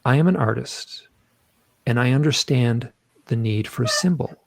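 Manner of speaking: deliberate recitation, broken by long pauses; very clear. Retold or imitated. Reciting his words from hypnosis, very clear and measured. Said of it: "I speak very clearly".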